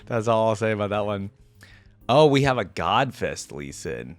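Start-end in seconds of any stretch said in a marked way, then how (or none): none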